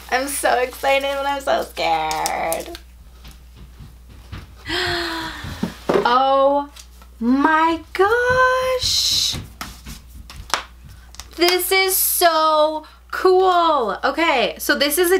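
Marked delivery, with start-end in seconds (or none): 1.1-2.8 mock scared. Real, not acted. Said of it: "I'm nervous". 4.7-14.0 gasp, then a high, very slow oh my gosh; gasp of delight. Real, not acted. Finding the Loungefly item, she gasps in delight.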